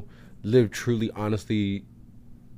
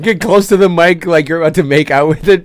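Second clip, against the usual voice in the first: playful yelling; 6 semitones higher, 11 dB louder than usual. Pretend.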